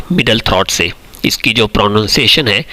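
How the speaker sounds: ordinary talk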